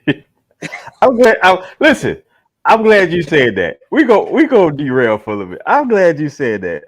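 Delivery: loud, high, laughing excitement; loud delighted outburst; excited laughing. Real, not acted. Excited reaction to debate mention.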